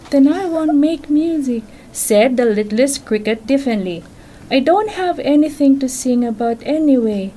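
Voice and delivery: bedtime-story reading voice; reading to children. Acted. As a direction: read it to a child on your lap.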